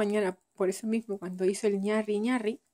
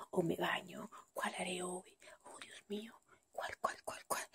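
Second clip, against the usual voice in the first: frantic unvoiced whisper; 13 dB quieter than usual. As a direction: whisper the impossible choice. Torn tired mom's inner dilemma.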